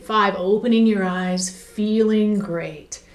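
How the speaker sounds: slow, drawn-out hypnotist count; hypnotic emerging cue. Shown as a demonstration. Hypnotist counting a client out of trance, slow and drawn out. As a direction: stretch the cue out slowly as if bringing a client up. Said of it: "and then, you know".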